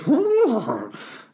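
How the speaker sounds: high horse whinny; botched elephant trumpet that comes out as a neigh; horse noise. Shown as a demonstration. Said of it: "that's a very much a horse noise".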